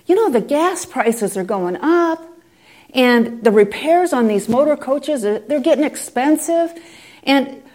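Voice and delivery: mild mock whine to the Lord; excuse-making Ken.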